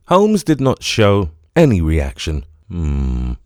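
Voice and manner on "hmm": a low, soft grumbling hum; low dismissive grunt; low grumble. A narrator's reading. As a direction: grumble under the breath, unimpressed. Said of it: "he grumbled".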